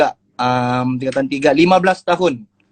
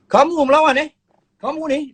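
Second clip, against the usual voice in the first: angry teacher; 10 semitones higher than usual. Retold or imitated. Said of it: "dia nak gitu".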